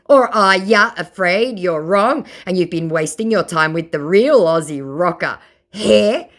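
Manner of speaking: indignant mock scammer. Mocking the scammer's complaint, high whiny mock voice. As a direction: sound put-out and whiny.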